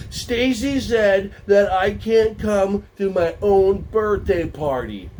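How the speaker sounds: mock tattletale whine; tattling child. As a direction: drag out the complaint like a pouting child. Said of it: "Tammy comes up to you and goes".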